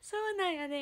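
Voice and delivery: unsure Kansai lilt; awkward Kansai dialect. Shown as a demonstration. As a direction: try a Kansai accent, half laughing at it. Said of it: "今ちょっと変じゃない？".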